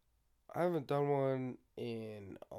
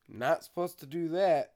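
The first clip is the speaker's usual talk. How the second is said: normal talking voice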